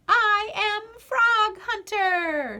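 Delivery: high, slow, loud proud shout; a little girl yelling her hero name. A narrator's reading. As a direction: announce yourself like a hero at full volume. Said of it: "shouted Maggie".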